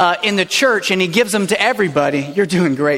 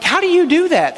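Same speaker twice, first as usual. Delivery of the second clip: high, louder mock astonishment at a baby; mock amazement; 10 semitones higher, 3 dB louder than usual. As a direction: squeal it in disbelief. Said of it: "and you're like".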